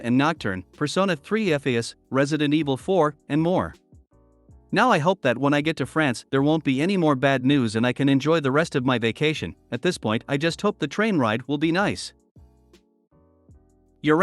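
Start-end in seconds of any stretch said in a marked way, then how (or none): none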